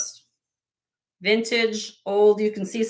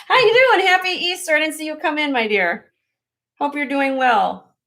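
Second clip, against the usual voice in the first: greeted much higher and louder, laughing with joy; delighted greeting; 7 semitones higher, 4 dB louder than usual. Real, not acted. Greeting friend arriving on stream.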